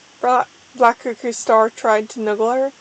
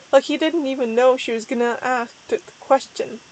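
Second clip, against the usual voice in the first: ordinary delivery, nothing marked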